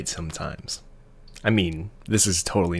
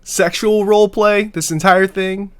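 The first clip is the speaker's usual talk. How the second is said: shocked; 10 semitones higher, 7 dB louder than usual. Real, not acted. Shocked by the kink reveal.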